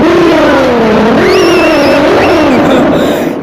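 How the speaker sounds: revving engine noise with laughter; race car zooming past. Pretend. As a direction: zoom like an F1 car. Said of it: "Make a Formula One sound".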